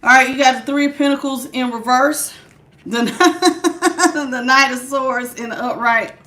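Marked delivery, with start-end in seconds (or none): 3.1-4.2 short high giggle; quick helpless titter. Real, not acted. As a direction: burst of stifled giggling mid-word. Laughs while reading the cards.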